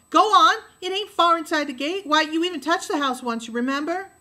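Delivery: high, loud goading call; a sister loudly daring her brother; taunting shout. A narrator's reading. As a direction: dare him loudly. Scout taunts Jem to fetch tire.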